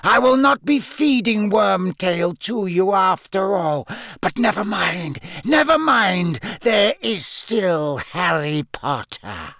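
high, icy villain, drawn out; cold voice. Acted. Voldemort to his snake Nagini, cold high voice. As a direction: console the snake in a high, sinister croon. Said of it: "said the cold voice".

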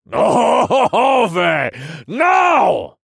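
very high, loud scream; crazed villain's screamed command; bellow. A narrator's reading. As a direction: bellow the order at full force. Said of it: "Vinra bellowed".

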